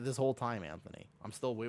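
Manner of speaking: ordinary talk, nothing marked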